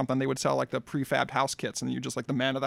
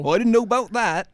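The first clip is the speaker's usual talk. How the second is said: higher, louder Hobbit accent; 6 semitones higher, 5 dB louder than usual. Shown as a demonstration. Demonstrating an accent to co-host, Hobbit accent. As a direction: play a Hobbit with a rustic British lilt. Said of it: "a Hobbit accent".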